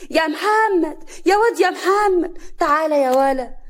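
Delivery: loud, high summons to a son; calling out. Acted. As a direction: yell for him to come down. Mother calls her son to come.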